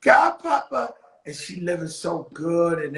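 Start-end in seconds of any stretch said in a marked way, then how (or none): 0.0-0.9 lightly voiced little girl, higher and louder; a hint of a little girl calling out; goddaughter's voice. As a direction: mimic a little kid calling her godfather. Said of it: "My goddaughter go".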